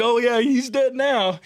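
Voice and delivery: high, loud, slow and drawn out; excited slow reply. Retold or imitated. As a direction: savour it, grinning. Partner's reply retold.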